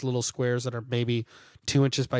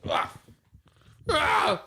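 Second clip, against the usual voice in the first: dad's pained yell; 13 semitones higher than usual. Retold or imitated. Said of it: "My dad used to do that".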